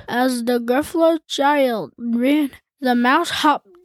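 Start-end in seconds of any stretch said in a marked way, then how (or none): none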